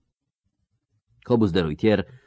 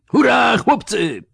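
very high, drawn-out rallying yell; battle roar; 5 dB louder than usual. A narrator's reading. Old count waving his mace at his men, roaring. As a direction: roar to your men at full voice. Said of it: "ryknął, wywijając buławą".

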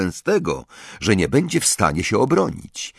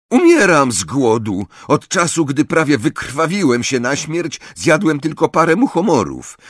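higher, louder grumpy outburst; snapping; 7 semitones higher, 4 dB louder than usual. A narrator's reading. Hungry Ron rages at his friends, snapping. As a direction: complain crossly at raised pitch. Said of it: "warknął na Hermionę".

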